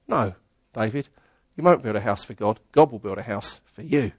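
low, hushed voice of God answering; a quiet, low God speaking; God's voice. Acted. God's reply to David, voiced.

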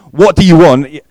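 loud, high, abrupt challenge; abrupt demand. Shown as a demonstration. Preacher demonstrates Jesus's question, loud and abrupt. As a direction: throw the question out loudly and abruptly. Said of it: "at least a little bit abrupt".

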